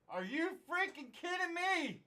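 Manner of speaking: usual speaking voice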